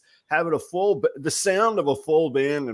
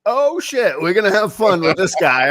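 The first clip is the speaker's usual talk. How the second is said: much higher, louder, thrilled; a guitarist grinning, sure this will be fun; delighted surprise; 7 semitones higher, 7 dB louder than usual. Retold or imitated. Guitarist's delighted grin mid-song.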